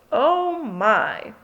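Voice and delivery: usual speaking voice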